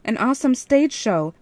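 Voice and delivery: nervous show-off enthusiasm; piping up. A narrator's reading. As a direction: oversell the excuse with gusto. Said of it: "I piped up".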